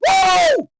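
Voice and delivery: very high, loud "woo"; wild whoop. Real, not acted. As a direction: let out a piercing cheer. Cheering a big moment.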